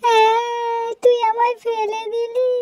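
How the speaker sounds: high, loud wailing complaint; crying wail. Acted. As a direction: wail like a child who was just pushed over. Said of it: "এত জপ চিৎকার করছো কেন".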